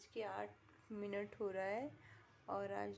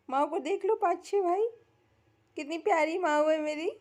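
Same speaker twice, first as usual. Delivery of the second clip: high, loud excited gushing; high loud gushing to viewers about her pet; 5 semitones higher, 12 dB louder than usual. Real, not acted. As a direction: gush over her like a proud owner. Gushing loudly over pet.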